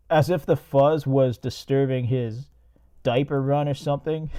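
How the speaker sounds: ordinary talk, nothing marked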